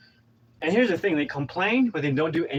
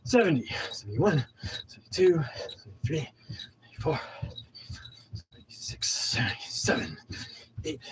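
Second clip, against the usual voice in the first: panting while counting; breathless counting; out of breath; 5 dB quieter than usual. Real, not acted. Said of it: "I'm out of breath".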